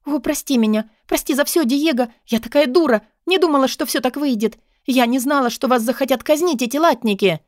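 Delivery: high, agitated apology; high-pitched contrite young woman; pleading Katarina. A narrator's reading. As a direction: beg forgiveness in a rush of feeling. Voices Katarina begging forgiveness.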